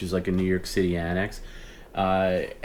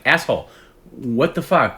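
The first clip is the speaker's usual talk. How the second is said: louder, higher retold outburst; disguise dropped, angry; 5 semitones higher, 6 dB louder than usual. Duped buyer confronting the scammer, angry with disguise dropped. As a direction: confront them in your own voice, indignant. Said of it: "then I dropped the voice".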